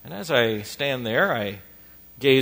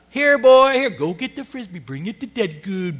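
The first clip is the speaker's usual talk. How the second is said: high, singsong calling to a dog; mock cheery dog owner; 8 semitones higher, 11 dB louder than usual. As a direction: call the dog in a put-on, high voice. Imitates carefree dog owner calling.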